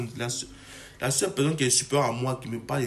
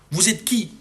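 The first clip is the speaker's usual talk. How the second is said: higher, louder scornful question; contemptuous challenge to other creators; indignant challenge; 6 semitones higher, 4 dB louder than usual. Real, not acted. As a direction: demand it of them with contempt. Ranting at fellow creators.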